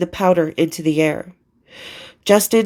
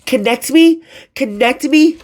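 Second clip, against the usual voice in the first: higher, indignant echoed question; incredulous; 5 semitones higher than usual. A narrator's reading. Caller balks at being transferred to nine one one, incredulous. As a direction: throw it back in disbelief. Narrated incredulous thought at operator.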